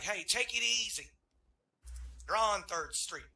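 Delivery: calm him down firmly, loud; loud mock cop telling him to relax. Cop calming high driver.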